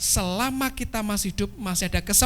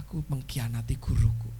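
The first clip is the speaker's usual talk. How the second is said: despairing whisper; 9 semitones lower than usual. Acted. Judas in despair.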